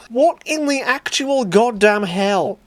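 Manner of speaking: slow, high, loud outraged disbelief; incredulous. Real, not acted. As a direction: spell each word out in shock. Reacting to absurd car.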